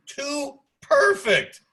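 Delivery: high, loud, drawn-out exclamation; exclaimed disbelief. Real, not acted. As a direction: stretch both words out in loud disbelief. Echoes answer in outraged disbelief.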